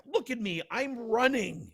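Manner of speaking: high, slowed mock protest; mild comic exasperation. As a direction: lightly replay an indignant answer. Retells the exasperated answer.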